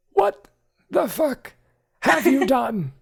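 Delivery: high, loud outraged butler; a manservant losing his composure; shocked high shout with a laugh breaking in; exasperated butler. Acted. Archimedes outburst.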